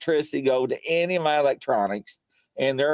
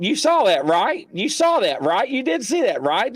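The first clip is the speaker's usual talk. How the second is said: retold urgent checking; 7 semitones higher than usual. Retelling own urgent check with caller.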